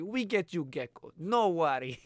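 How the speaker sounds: soft, broken-English Russian reassurance; a lightly put-on Russian accent. The Russian lady reassuring the teens, soft mock accent. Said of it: "she's like".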